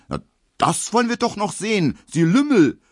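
angry outcry. A narrator's reading. Emil shouts back at the big butcher, high angry retort. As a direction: fire back red in the face. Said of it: "ruft Emil Kubinke".

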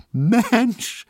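a high, loud yelp of glee; excited shout. Acted. Tesch bursting into the attic room, high excited shout. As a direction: call out, bursting with laughter. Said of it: "schrie Herr Tesch und hielt sich die Seiten vor Lachen".

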